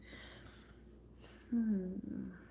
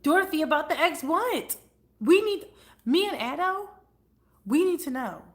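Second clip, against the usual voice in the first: higher, louder insistent pleading; excited demand; 7 semitones higher, 8 dB louder than usual. Real, not acted. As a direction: loudly beg the viewer for the story. Demanding the viewer's gossip.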